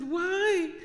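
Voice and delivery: high, loud wail of objection; retold dismayed protest. Daughter told by dad to give up scholarship, high protesting cry.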